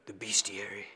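low, whispery impression voice. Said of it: "The voice has to be down here".

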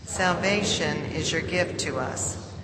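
usual speaking voice

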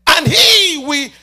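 loud, ringing preacher's cry over the music; thundering sermon delivery; full-force preaching. Acted. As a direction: preach it out to the back row.

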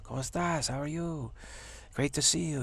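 put-on breezy Spanish-accented hello to old friends; Juan Carlos. Cool friend breezing in by Ferrari, suave greeting. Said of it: "he's Juan Carlos".

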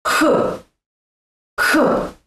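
"H." breathed out as a rough throaty Russian H; Russian H sound demo. Shown as a demonstration. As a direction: push "H." out on the exhale, tongue high like a Russian. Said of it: "Try this".

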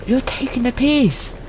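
loud high outraged ranting; furious rant. Real, not acted. Player raging at teammates who left him in the storm, furious. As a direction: vent the betrayal at full volume.

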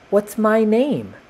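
high, slow little character voice; storybook paintbrush. A narrator's reading. New paintbrush asks for a name.

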